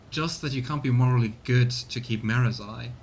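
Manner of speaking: ordinary talk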